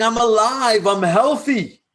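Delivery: high, loud, exuberant declaration; voice of sexual energy. Acted. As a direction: proclaim it high and loud, as life force speaking. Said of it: "Sexual energy is saying".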